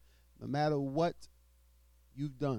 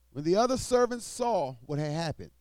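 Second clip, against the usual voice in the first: loud, raised preaching read; scripture reading; 5 semitones higher, 6 dB louder than usual. Acted. Preacher reading Matthew aloud to the congregation, loud and proclaiming.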